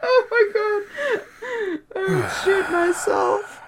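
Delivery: high, slow terrified wail; mock terror. Real, not acted. Reacting to the singer's warmup, mock fear. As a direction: squeal it in comic horror.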